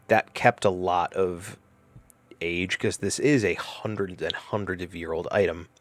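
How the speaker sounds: ordinary talk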